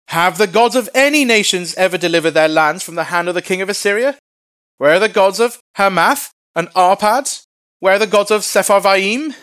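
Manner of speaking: high, loud herald's proclamation; calling out. Acted. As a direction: shout it to a crowd on a city wall. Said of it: "stood and called out in Hebrew".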